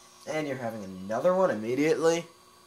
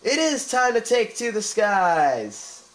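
very slow, higher, louder grand proclamation; slow, soaring shout of "it is time to take to the skies"; triumphant slogan; 9 semitones higher, 10 dB louder, much slower than usual. Shown as a demonstration. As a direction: boom it out like a hero's battle cry. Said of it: "maybe that should be my channel title".

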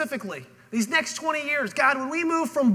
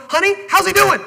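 calling home; 7 semitones higher, 11 dB louder than usual. Pretend. Imagined phone call home.

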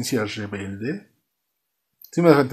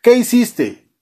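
high, raised accusing voice; mock angry outburst; 9 semitones higher, 4 dB louder than usual. Father confronts his confessing son, mock angry. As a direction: play a furious dad, hammed-up.

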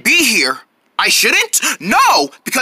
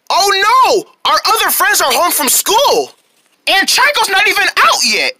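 high-pitched, mock panic; acted alarm; 7 semitones higher than usual. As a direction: exclaim high as unwanted company arrives. Panic as friends arrive.

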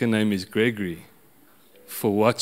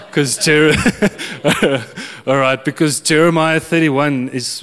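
laughing; 5 semitones higher, 5 dB louder than usual. Real, not acted. Laughs mid-sentence at himself.

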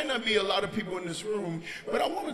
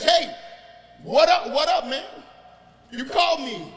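bouncy, louder acted greeting; eager young Samuel; 3 semitones higher, 6 dB louder than usual. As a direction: burst in ready to help. Acts Samuel rushing to pastor.

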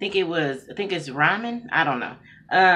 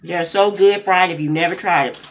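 talking while chewing; muffled through food; mouth full; 8 dB louder than usual. Real, not acted. Seen 1: Food put in mouth at -4; chewing, pursed lips while speaking.

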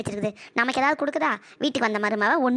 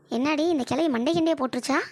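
ordinary delivery

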